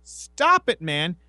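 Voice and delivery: high put-on plea then sorry; mock scolding. Telling Kevin off mid-movie, high mock scolding. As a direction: bark it high then back down.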